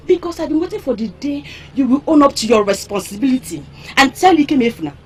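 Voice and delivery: normal talking voice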